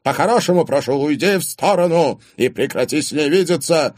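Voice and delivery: loud, high, forceful demand; angry warning. A narrator's reading. Father tells boyfriend to stop seeing her, angrily. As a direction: warn him off firmly.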